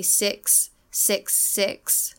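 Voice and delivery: six with a lax, short I; six, vowel loose and short. Shown as a demonstration. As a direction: say six with relaxed lips, not seeks. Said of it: "if you relax your lips and tongue".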